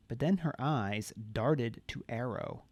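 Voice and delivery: usual speaking voice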